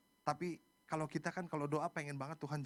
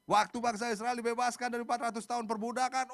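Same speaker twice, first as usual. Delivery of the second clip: high, raised pulpit voice; fervent preaching; 9 semitones higher, 7 dB louder than usual. Real, not acted. Preacher assuring congregation God is at work, fervent. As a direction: proclaim it with conviction to the crowd.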